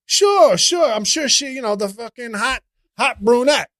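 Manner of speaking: high, loud, incredulous sarcasm; sarcastic agreement. Acted. Comic answering the annoying guy, loudly sarcastic. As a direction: blurt mock agreement way up high. Sarcastic reply to the mocked character.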